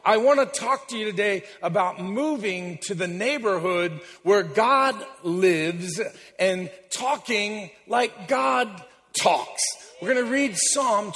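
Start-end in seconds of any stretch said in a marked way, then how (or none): none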